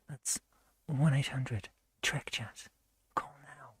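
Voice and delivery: slow, low, hushed phone-line plug; late-night chatline advert. Pretend. Mock advert urging viewers to call Trek Chat, hushed pitch. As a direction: sink to a whisper at the end. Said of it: "All those old adverts".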